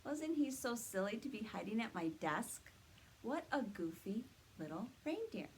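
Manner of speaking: normal talking voice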